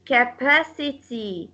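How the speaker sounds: "capacity" with second syllable PA stressed. Shown as a demonstration. Teacher gives a rule's example, PA stressed in "capacity". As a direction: lean on PA in "capacity". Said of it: "go on the second syllable".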